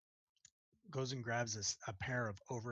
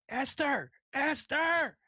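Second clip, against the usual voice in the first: much higher, loud call across the kitchen; yelling for help; 12 semitones higher, 7 dB louder than usual. Acted. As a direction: bellow the helper's name. Cook yells to kitchen helper.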